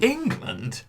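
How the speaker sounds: high questioning England with a dropping tone; unsure of herself, asking England; doubting. Real, not acted.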